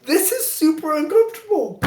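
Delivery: high-pitched comic unease; mock-awkward squeak; squirming discomfort. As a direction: voice climbs with embarrassment. Retelling discomfort in underwear.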